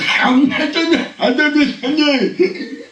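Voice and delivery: high squeaky nervous laugh; forced high-pitched giggle; awkward laugh. Acted. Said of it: "all that comes out is".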